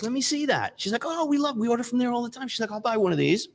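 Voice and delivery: lady at the door. Retold or imitated. Lady at the door wants Pizza Hut coupons, high and eager. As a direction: chirp it up high and keen.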